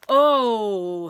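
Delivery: loud sudden "Oh" of remembering; the giant suddenly remembers; BFG's cry of realisation. Acted. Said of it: "he cried at last".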